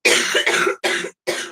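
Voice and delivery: real hacking cough; coughing fit. Real, not acted. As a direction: cough hard a few times. Coughing fit mid chat.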